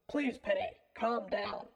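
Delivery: mild, understated plea; pleading. A narrator's reading. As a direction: plead gently to calm someone. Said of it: "one of the men pleaded".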